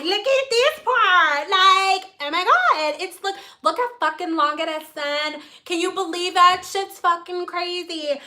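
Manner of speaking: mock Valley girl showing off. Client marvelling at her hair length, mock Valley girl. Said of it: "this the same girl that's Valley girl".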